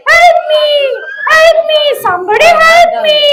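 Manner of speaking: shouting for help. Acted. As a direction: call out trapped and panicking. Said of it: "Simba cried and shouted".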